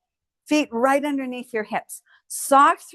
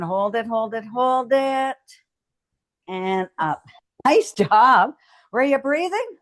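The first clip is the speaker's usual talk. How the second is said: usual speaking voice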